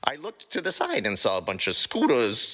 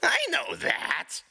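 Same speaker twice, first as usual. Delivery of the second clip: defensive; slower than usual. Acted. Rocket defensive.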